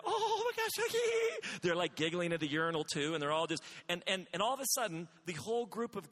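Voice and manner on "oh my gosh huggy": put-on much higher squealing girl voice; giggly schoolgirls. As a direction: play a giddy fifth grader.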